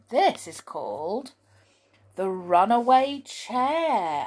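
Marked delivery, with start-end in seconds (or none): 2.2-4.3 slow, high, announced chapter name; chapter title. Shown as a demonstration. Reader announcing the chapter to children, slow and grand. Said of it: "announcing chapter title".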